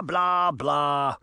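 dismissive, dropping blah blah; trailing mock blah. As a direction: brush him off with boredom. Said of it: "Uncle Jake mocked".

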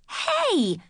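sharp high yell of protest; a startled, indignant shout. Acted. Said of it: "I yelled".

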